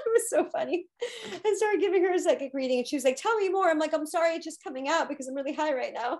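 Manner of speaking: high, laughing chatter; giggly. Real, not acted. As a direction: bubble over with giggles while telling it. Giggly after laughing at the story.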